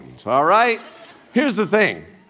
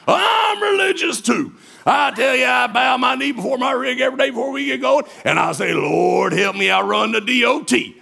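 booming trucker; 3 semitones higher than usual. Retold or imitated. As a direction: proclaim it like a proud trucker. Trucker boasting his faith.

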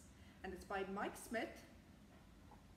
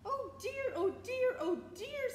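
very high, loud repeated fretting; exaggerated dismay; 9 dB louder, slower than usual. A narrator's reading. Bus driver watching the bonnet fly away, high loud dismay. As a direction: wail it in storybook panic, each one pushed.

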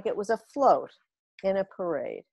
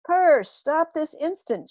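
slow, loud, higher command; stern shout; 8 semitones higher, 5 dB louder, slower than usual. A narrator's reading. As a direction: order the cat to stop. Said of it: "shouted Rabbi Rocket Power".

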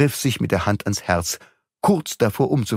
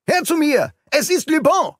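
calling out. A narrator's reading. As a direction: shout to the servants. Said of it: "während er den Dienern zurief".

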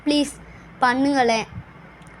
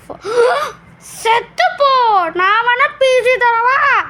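high, loud, drawn-out yell; angry retort; 10 semitones higher, 4 dB louder, much slower than usual. Acted. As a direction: shriek back indignantly, stretching the words. Mock rage over lacking a PC. (கோபமா இழுத்துக் கத்தல்)

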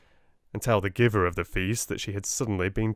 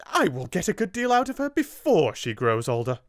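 higher and louder, a sharp exclamation; 9 semitones higher, 5 dB louder than usual. Acted. Headmistress retorts to the solicitor, loud and high. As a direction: snap it back with spite. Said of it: "exclaimed Miss Minchin".

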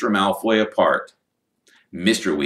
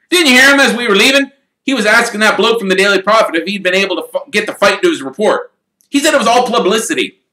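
fast, higher, eager chatter; gleeful; 12 semitones higher, 8 dB louder, faster than usual. Acted. Son gleefully telling parents about the publicity, fast. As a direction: rush the gossip out with glee. Fred gleefully reporting gossip.

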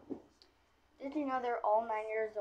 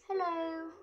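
character voice; 7 semitones higher, 4 dB louder than usual. Pretend. Trying out a doll's voice.

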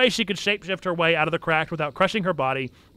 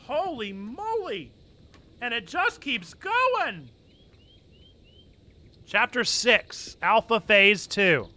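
exaggerated amazement; 6 semitones higher, slower than usual. Real, not acted. Dramatic reaction to long chapter.

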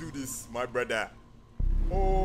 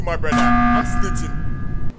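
drawn-out, booming announcement; big mock-dramatic holler; loud accusation; 2 semitones lower, 11 dB louder, slower than usual. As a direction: blare it out, stretching the last word. Announces he is snitching.

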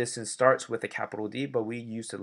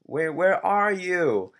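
called out higher and louder, slow; calling out; 7 semitones higher, 6 dB louder, slower than usual. Acted. Bob calling loudly to find his friend. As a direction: shout across to find Joe. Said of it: "And then Bob goes".

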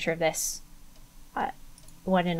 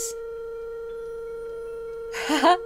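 high giggle of delight; delighted laugh; 14 semitones higher, 4 dB quieter than usual. Real, not acted. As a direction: laugh with pure glee. Delighted after Cockney bit.

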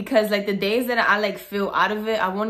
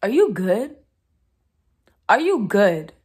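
louder, sharp incredulous question; 5 dB louder than usual. Real, not acted. Vlogger asking a commenter if they're okay, incredulous. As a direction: demand it in disbelief. Reacting to being called a cheater.

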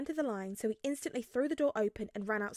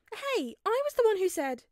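high, loud indignant cry; offended protest; 11 semitones higher, 7 dB louder than usual. A narrator's reading.

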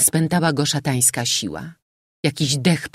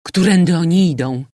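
raised-voice urgent question; exclaiming; 3 semitones higher, 6 dB louder than usual. A narrator's reading. General listening for the fugitives' steps, exclaiming. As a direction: call it out loudly. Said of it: "wykrzyknął generał".

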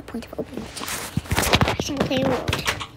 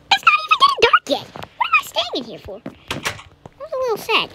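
squeaky high. Real, not acted. Surprised the sky isn't dark.

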